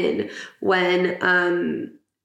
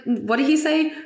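usual speaking voice

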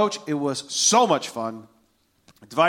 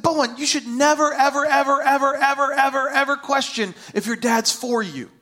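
high, insistent fatherly plea; emphatic, raised-pitch reassurance to a son; impassioned insistence; 9 semitones higher than usual. Retold or imitated. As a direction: pile up each "ever" with rising urgency.

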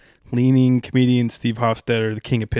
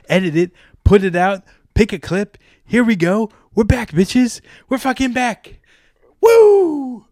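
rising higher and louder into a shout; building hype; 9 semitones higher, 4 dB louder than usual. Acted. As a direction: hype yourself up until you cheer.

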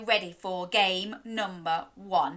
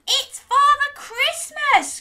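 high, loud, slow excited shout; excited cry; 10 dB louder, slower than usual. Acted. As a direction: cry out thrilled it's Santa. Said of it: "cried one of the aunts".

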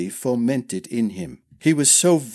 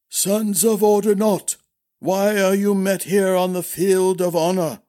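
put-on formal old master; 8 semitones higher than usual. A narrator's reading. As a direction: proclaim to the crowd.

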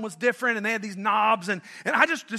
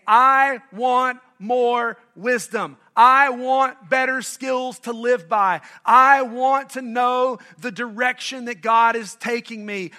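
loud, drawn-out declaration; definitive; 2 semitones higher, 7 dB louder, slower than usual. Acted. Preacher voicing Solomon's challenge to seek wisdom, loud and definitive. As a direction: declare each want slowly and firmly. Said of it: "definitively saying".

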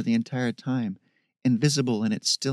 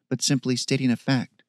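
plain reading voice

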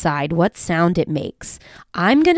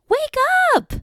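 calling out. Pretend. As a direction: shout them awake. Calling bunnies awake.

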